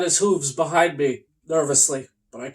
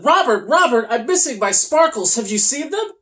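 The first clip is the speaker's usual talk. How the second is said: higher, louder eager unicorn voice; Toby calling out; 6 semitones higher, 4 dB louder than usual. Acted. Unicorn calling out to his friend Robert. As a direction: rush up calling your friend's name. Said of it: "Toby ran up to his friend and said".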